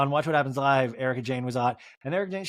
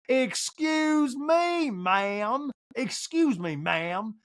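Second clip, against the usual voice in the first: mock Sutton; 9 semitones higher, much slower than usual. Sutton voiced in recap.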